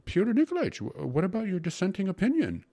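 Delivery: high, loud, flustered question; shrieking dismay. A narrator's reading. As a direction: call out in alarm, stumbling over the words. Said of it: "asked the secretary in dismay".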